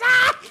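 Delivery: sudden loud high yelp. Real, not acted. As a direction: let out a sharp high cry. Sudden yelp mid-song.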